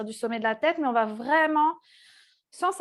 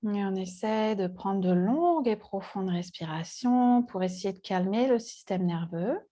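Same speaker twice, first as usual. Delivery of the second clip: guided meditation; 4 semitones lower than usual. Acted. Yoga teacher leading seated students into body awareness, calm meditative guiding. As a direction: guide them calmly into the breath, with long pauses.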